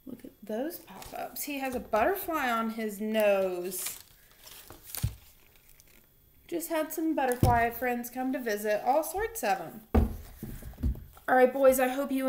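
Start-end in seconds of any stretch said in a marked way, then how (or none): 0.1-1.4 much softer, marvelling aside; hushed wonder. Acted. Teacher shows the boys the pop-ups, softly amazed. 1.4-9.8 lively storybook reading voice; reading to children. Acted. Teacher reading a picture book aloud to her boys, animated.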